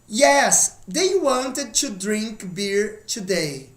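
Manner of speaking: slow, careful English model; slow model sentence. Shown as a demonstration. Teacher modelling the past tense slowly. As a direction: spell it out slowly for the class. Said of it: "Então vamos lá, ó".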